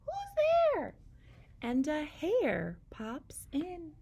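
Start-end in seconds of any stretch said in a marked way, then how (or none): 0.0-0.9 tiny, very high squeaky voice; Mouse cries. A narrator's reading. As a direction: squeak it like a scared little mouse. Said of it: "Mouse cries".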